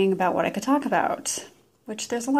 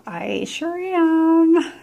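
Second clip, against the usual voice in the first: playful; 9 semitones higher, 6 dB louder, much slower than usual. Real, not acted. Playful self-affirmation.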